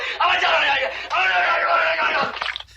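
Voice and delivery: high giggle breaking out; high laugh. Real, not acted. Host cracks up at his own hysterical impression, laughing.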